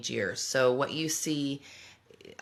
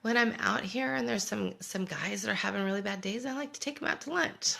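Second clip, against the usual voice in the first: higher, innocent son voice; son's sweet excuse; 9 semitones higher than usual. Retold or imitated. Son explaining to mom why money is gone, higher innocent voice. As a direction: play the earnest son, lifting the voice. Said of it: "He goes".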